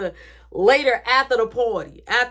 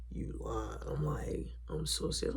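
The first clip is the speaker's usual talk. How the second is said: unvoiced hushed whisper; 12 semitones lower, 15 dB quieter than usual. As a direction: keep it a secret whisper. Whispered exchange with Mark.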